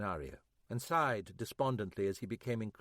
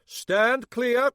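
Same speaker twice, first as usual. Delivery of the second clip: loud, high yell; 12 semitones higher, 11 dB louder than usual. Acted. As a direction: bark a warning before the kick. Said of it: "he yelled".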